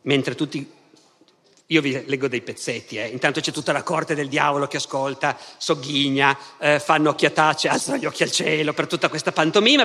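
plain reading voice

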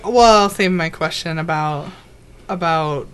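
ordinary talk, nothing marked

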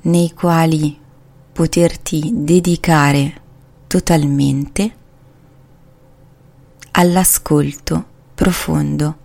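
unhurried, calming meditation voice; guided meditation. Acted. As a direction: ease the listener into relaxation, slowly. Leads a guided body scan.

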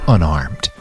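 usual speaking voice

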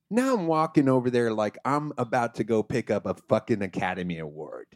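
ordinary delivery

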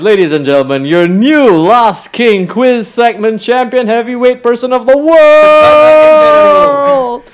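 rising ring announcer build. Pretend. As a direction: build the title to a big finish. Mock announcing the new champion.

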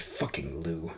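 low muttered curse. Acted. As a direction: mutter it under your breath. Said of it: "Jeremy muttered".

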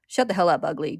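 loud opening snap, dropping on the insult; snapping. A narrator's reading. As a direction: snap it curtly with mild anger. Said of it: "Greed snaps".

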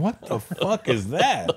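imitated disgusted disbelief. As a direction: mimic a friend waking to a stink. Friend wakes to the same smell.

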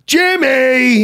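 loud, high called-out name; imitated impersonation. Comedian shows his friend's impersonation of him, loud and high. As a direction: shout it high as a caricature. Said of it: "his impersonation of me".

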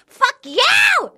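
hammed-up high shout at him; mock furious yelling. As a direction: scream it like a jilted woman. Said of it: "fucking enraged".

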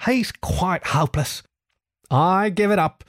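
high, exasperated resignation; despairing Rat. A narrator's reading. Rat telling Mole Toad is hopeless, despairing. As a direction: give up in mild despair. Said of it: "The rat turned from him in despair".

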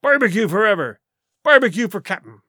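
loud pirate acclamation; cheering crew. A narrator's reading. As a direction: cheer him on as the whole crew. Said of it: "they cried".

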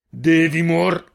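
high, loud, very slow cut-off bellow; a killer's last roar, cut short; furious roar. Acted. Hunter roaring at Girin before the arrow: high loud bellow. Jofix roars while lifting Girin.

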